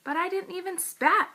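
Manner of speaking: put-on indignant child caught off guard; surprised protest. A narrator's reading. Junie B protests to her teacher in high surprise. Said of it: "I looked surprised at that man".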